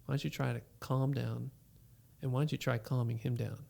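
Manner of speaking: God answering the father's prayer, softly; God's gentle voice. Retold or imitated. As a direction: speak quietly like a kind guide. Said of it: "he goes".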